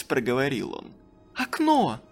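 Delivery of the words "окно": high, louder urgent cry; quick answer. Acted. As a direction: point to the way out. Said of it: "откликнулась она". (быстро, громко)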